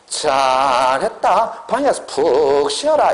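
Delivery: mock-praising mom, first word stretched very long; 반어적인 엄마. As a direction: draw out the praise like an ironic mother. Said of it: "엄마 뭐라 그래요". (길게 끌며 비꼬듯 칭찬하는 엄마 말투)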